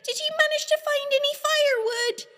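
high, fast, loud call. Acted. Lisa calling to Rodney from the kitchen, high and loud. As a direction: call sweetly through the house.

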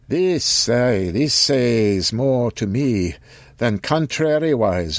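put-on high, slow archaic old voice; an old man musing in olden speech; old man Occam.

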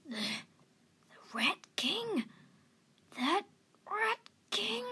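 halting, high rising disbelief in a croaky voice; wide-eyed shock. A narrator's reading. Old cat hearing of the Rat King, shocked. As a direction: stammer it, stunned. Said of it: "The old cat's eyes widened".

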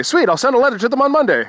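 overly chipper, teasing the guard; mock-eager reply. Mocking the mall security guard with fast, fake cheer. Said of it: "totally making fun of him".

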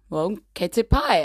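normal talking voice

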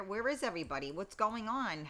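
usual speaking voice

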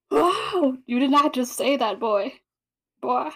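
high, loud mock-scandalized whoa; shocked reaction. Reader recalls reacting to Matthew's remark, loudly scandalized. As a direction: exclaim it in stunned disbelief, then scold.

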